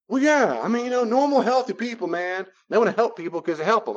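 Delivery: high-pitched imitation of someone justifying themselves; insistent protest. Acting out the insistent other person.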